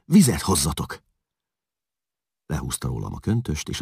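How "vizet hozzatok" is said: urgent call. A narrator's reading. Woman orders servants urgently.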